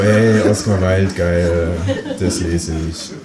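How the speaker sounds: mock-impressed reader, drawn out; impressed book buyers. Audience aside imitating impressed readers, drawn-out mock awe. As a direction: act out people being easily impressed. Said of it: "dann sind Leute beeindruckt".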